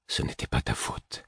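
very soft, low, almost whispered comfort; hushed reassurance. A narrator's reading. Narrator consoles the Fool through their link, hushed. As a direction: barely voice it, gently consoling.

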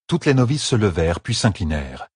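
ordinary delivery

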